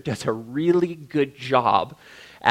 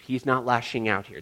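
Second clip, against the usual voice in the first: ordinary talk, nothing marked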